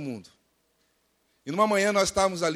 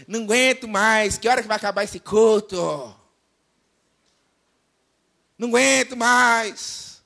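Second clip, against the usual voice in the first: loud, drawn-out fed-up whine; mock grumbling; 4 semitones higher, 7 dB louder than usual. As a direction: moan it like an impatient churchgoer. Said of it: "Gente que murmura".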